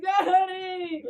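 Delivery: high, loud plea to a parent; exaggerated needy wail; mock distressed child. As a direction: call out for dad in hammed-up panic. Retold panicked call to father.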